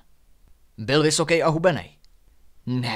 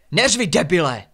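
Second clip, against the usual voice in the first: louder, sharp telling-off; snapping back; 4 semitones higher, 6 dB louder than usual. A narrator's reading. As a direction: cut him off sharply. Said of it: "okřikla ho Renata".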